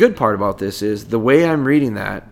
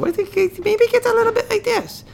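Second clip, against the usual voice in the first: much higher, squeaky excited voice to a puppy. Shown as a demonstration. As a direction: talk to the puppy like you're thrilled to see it. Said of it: "I bet you your tone".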